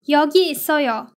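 usual speaking voice

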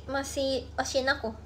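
ordinary delivery

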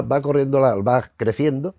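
ordinary talk

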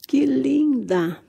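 slow, swooning compliment; drawn-out admiration. Real, not acted. Vlogger showing a pretty succulent, slow admiring gush. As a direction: stretch the praise out lovingly. Gushing over a plant.

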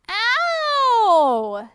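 high pained yelp; crying out. Acted. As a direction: yelp it out in pain. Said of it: "he cried".

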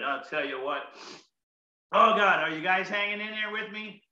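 usual speaking voice

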